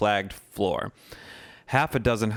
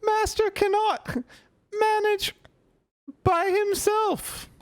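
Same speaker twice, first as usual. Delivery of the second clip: very high, trembling elf whimper; whimpering house-elf. Acted. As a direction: whimper it like a lost little elf. Winky distressed about master.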